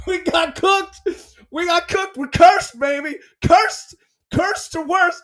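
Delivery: high, loud wail of doom; mock anguish. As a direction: ham up the despair. Mock despair, pitched up.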